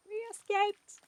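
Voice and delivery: high triumphant cheer. Real, not acted. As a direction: cheer it out again. Repeats cheer.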